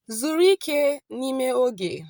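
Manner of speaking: slow, careful Igbo, vowels joined; slow Igbo demo. Shown as a demonstration. Teacher modelling Igbo for students, slow and clear. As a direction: model the phrase slowly for learners. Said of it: "how do you say relax in the moment".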